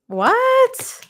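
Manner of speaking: sudden high squeak of disbelief; shocked what. Real, not acted. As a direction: yelp it out, stunned. Shocked by the tarot cards.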